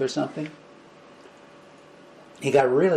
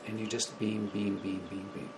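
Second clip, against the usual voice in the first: fading to a whisper; 5 semitones lower, 13 dB quieter than usual. Shown as a demonstration. Beaming love outward, fading.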